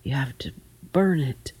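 hushed, halting mumble; subdued, hesitant admission; mumbled. A narrator's reading. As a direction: mumble it reluctantly into your chest. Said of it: "mumbled her grandson".

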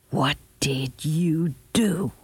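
very slow, deliberate question; drawn-out, weighty confrontation; appalled demand. A narrator's reading. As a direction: space out each word in stern horror. Grandmother horrified, confronting her grandson.

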